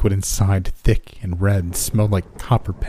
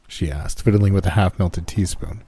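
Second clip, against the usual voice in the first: ordinary delivery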